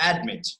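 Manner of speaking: "Admit" falling from a stressed AD; weight on the first half of "Admit"; stress on first syllable. Shown as a demonstration. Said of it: "stressing on the first syllable".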